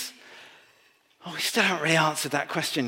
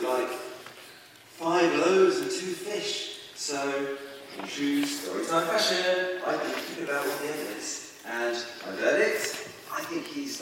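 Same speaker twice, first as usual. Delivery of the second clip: slow, chatty vlogger voice; breathless gossipy storytime. Pretend. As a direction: play a YouTuber telling a story. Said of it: "one of those story time videos".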